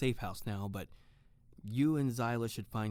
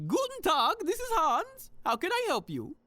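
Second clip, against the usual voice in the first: cheerful Hans; 6 dB louder than usual. Acted. Hans calling out to Tiffany that the toast is ready, high and cheerful. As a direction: bounce it high like a jolly host. Hans answers fridge call.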